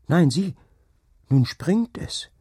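high, loud, astonished exclamation; excited boy. A narrator's reading. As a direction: cry out in wonder at the sight. Boy sees the horse jump.

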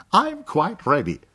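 high, bright-voiced young Englishwoman; English girl. A narrator's reading. Miss Rose announcing her sketch is done, high and cheerful.

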